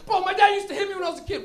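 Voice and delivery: high, loud panicked confession; freaking out. Acted. Back-seat guy confessing as everything comes out, high loud panic. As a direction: blurt it out in acted hysteria. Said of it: "Everybody's freaking out".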